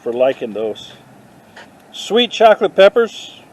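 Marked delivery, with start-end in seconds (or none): 1.9-3.4 Sweet chocolate peppers called out high and bright with surprise; excited reading, higher and louder. Pretend. Reading a plant tag to the camera, excited and higher. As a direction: announce it like a thrilling discovery. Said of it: "I'm gonna name my next band that".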